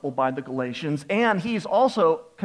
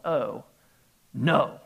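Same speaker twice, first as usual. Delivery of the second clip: sinking realisation; 4 semitones lower than usual. Retold or imitated. Dawning dread at coach's revelation.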